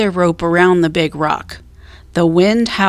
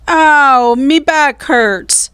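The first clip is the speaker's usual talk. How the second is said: high, slow pained cry; 7 semitones higher, 5 dB louder, much slower than usual. A narrator's reading. Pirate Stinky cries out in pain while digging. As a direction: wail about the sore back. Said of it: "Stinky cried".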